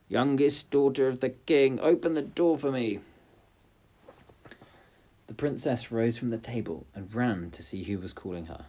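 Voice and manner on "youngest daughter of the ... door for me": high, loud frog call. Acted. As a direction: call through the door. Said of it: "it knocked and cried".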